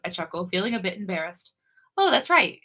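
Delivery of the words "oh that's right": higher, louder teasing realization; mock serious. A narrator's reading. He teases her about TV, mock serious. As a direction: feign seriousness with a smile in it. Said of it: "he says seriously, but I can hear the smile".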